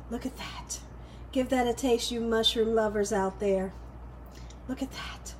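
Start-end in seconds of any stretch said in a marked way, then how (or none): 0.1-0.8 breathy whisper. Real, not acted. Hushed look at the food. 4.7-5.3 breathy whisper. Real, not acted. Hushed look at the food.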